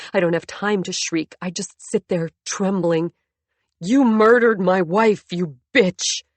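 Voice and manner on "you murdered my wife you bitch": loud, slow yell that cracks; yelling, voice breaking. A narrator's reading. Husband accusing wife of his first wife's death, cracking yell. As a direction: bellow it and let the voice break. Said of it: "He's yelling, but his voice breaks".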